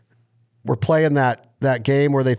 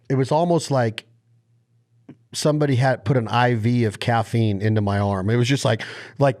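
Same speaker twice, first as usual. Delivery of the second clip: ordinary delivery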